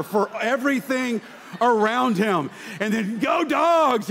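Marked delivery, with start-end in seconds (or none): 3.2-4.1 high, loud football-fan whoop; loud rowdy stadium shout; rowdy fan yell. Retold or imitated. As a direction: scream it like a drunk fan at a game. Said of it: "he's just screaming".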